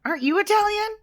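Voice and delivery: high, fast startled disbelief; shocked confusion. Retold or imitated. Viewer reacting to the villain, high shocked disbelief. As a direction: squeak out baffled surprise. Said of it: "And I'm like".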